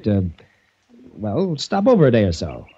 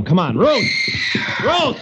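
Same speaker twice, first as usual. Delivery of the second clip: high, alarmed shout down the hallway; urgent calling; 10 semitones higher, slower than usual. Acted. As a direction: yell for her as you run. Running to find screaming Ruth.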